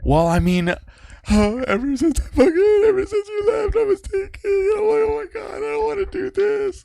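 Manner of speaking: high, whiny falsetto retelling her; hammed-up mimic of the ex; mock fake-crying girlfriend. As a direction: squeak out her excuse in a mocking falsetto. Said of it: "I fake cried to her".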